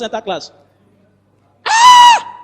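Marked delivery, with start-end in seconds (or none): 1.7-2.4 high shout. Retold or imitated. Stunned in class.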